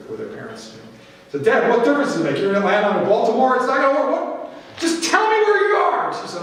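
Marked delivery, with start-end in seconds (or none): 1.4-6.1 put-on high, exasperated teenage pushback at dad; rising, impatient mock whine; frustrated daughter. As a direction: imitate a kid fed up with her parent. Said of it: "you know how frustrated kids can be".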